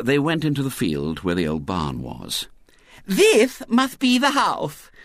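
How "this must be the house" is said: put-on very high, slow lisping little girl; pleased small girl playing house; Violet Elizabeth. A narrator's reading. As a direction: announce it grandly in a piping child voice. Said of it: "said Violet Elizabeth, her tears gone".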